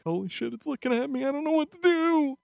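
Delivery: mock panic. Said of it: "But now she's just like".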